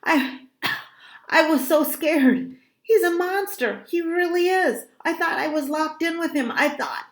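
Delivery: high, panicky outpouring; frightened, very high, insistent voice; out of breath, scared. A narrator's reading. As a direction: plead breathlessly to be believed. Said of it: "still out of breath".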